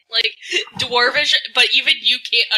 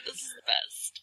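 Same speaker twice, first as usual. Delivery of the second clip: whispering; 2 semitones higher, 15 dB quieter than usual. Real, not acted. Delighted at the trick.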